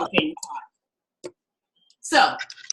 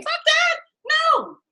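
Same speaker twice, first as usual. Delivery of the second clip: indignant refusal; much slower than usual. Retold or imitated. Refusing scale pay for Angela, a high outraged yell. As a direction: slam the idea down with a high yell.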